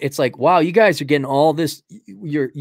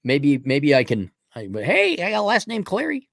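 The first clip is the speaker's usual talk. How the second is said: ordinary talk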